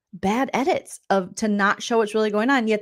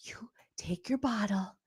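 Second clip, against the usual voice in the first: fast, much softer sinister whisper; eerie whisper; 6 semitones lower, 11 dB quieter, faster than usual. Retold or imitated. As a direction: hiss the curse quickly and secretly. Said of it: "She's like".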